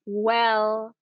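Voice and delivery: a long thinking "well."; long drawn-out "well". Shown as a demonstration. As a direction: stretch "well." out slowly. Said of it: "a kind of a long "well".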